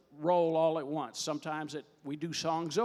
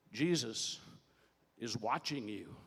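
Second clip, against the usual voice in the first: mysterious voice; 6 semitones lower, 5 dB quieter than usual. Acted. Comedian voices what the burglar hears, low mysterious voice. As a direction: drop low for an unseen watcher. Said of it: "he hears this voice".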